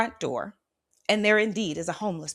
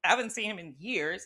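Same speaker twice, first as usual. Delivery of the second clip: ordinary talk, nothing marked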